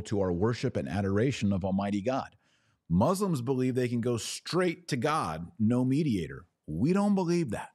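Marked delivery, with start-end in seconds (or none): 2.9-7.7 emphatic contrast. Real, not acted. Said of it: "emphatic contrast".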